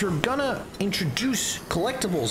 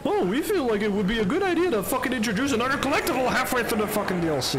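higher, sarcastic mock-dev voice; mocking developers; 7 semitones higher, faster than usual. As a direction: parrot the devs with scorn.

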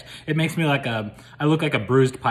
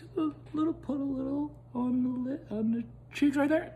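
high cooing; 11 semitones higher, 5 dB quieter than usual. Real, not acted.

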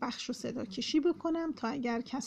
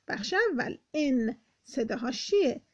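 ordinary delivery

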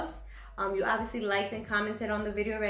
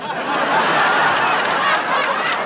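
loud laugh; 14 dB louder than usual. Real, not acted. After the camel punchline, loud high laughter.

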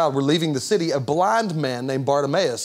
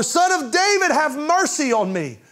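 even higher, loud pleading cry; the blind man shouting louder at Jesus despite the crowd; shouting all the more; 10 semitones higher, 3 dB louder than usual. Retold or imitated. Push the cry up higher, begging. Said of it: "He shouted all the more".

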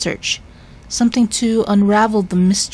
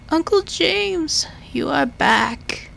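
put-on much higher, slow, wavering old man; confused old professor; 9 semitones higher, slower than usual. Sick professor mistaking his visitor, high and slow. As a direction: drift in like a lost old man.

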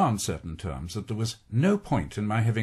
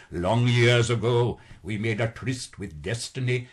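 light imitation of an Indian-English accent, slow; Nehru's Indian accent; slower than usual. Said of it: "he cultivated that accent".

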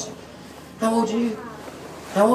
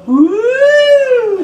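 excited whoop; 14 semitones higher, 4 dB louder than usual. Acted. Reveals silly pencil.

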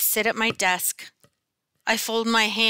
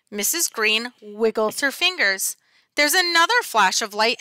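plain reading voice

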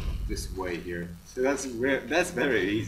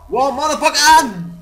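high, loud shocked outburst; startled yell; 12 semitones higher, 11 dB louder than usual. Real, not acted. Player startled by the game, high loud yell. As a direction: yelp the curse in real shock.

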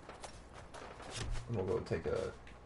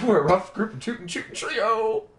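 cowboy voice; 9 semitones higher, 6 dB louder than usual. Pretend. Playful cowboy bravado.

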